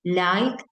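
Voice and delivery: liked with a short final T sound; liked, ending in T; English liked. Shown as a demonstration. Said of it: "por ejemplo".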